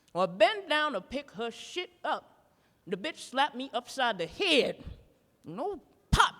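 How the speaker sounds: ordinary delivery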